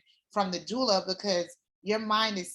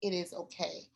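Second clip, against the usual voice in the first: reassuring quote; 3 semitones lower, 9 dB quieter than usual. Retold or imitated. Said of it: "was like".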